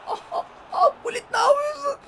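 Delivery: very high, thin squeal; high excited cry. Real, not acted. Gamer crying out to Gullit over his play, high squeal. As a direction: squeal it far above your speaking voice.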